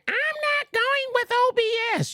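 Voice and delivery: very high cartoonish squeak of refusal; squeaky Carrie voice. Voicing Carrie's refusal of OBS in a high squeak. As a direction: pitch way up into a tiny squeak.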